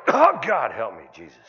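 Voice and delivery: mock old-man groan. Comedian mimicking an aging man's groan, hammed-up. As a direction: groan it like an aching body. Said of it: "You ever sit down and go".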